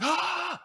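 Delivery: sudden high, loud gasping shriek; waking scream. Retold or imitated. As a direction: imitate screaming yourself awake. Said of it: "fully wake up and you're just like".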